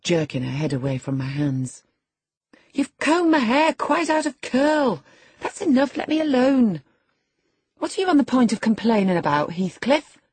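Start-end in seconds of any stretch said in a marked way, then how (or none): 2.7-6.8 petulant. A narrator's reading. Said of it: "she added petulantly". 7.8-10.2 high, loud, rapid question; cross challenge. Acted. Catherine crossly challenges Heathcliff.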